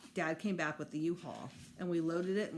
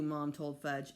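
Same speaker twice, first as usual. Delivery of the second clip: ordinary delivery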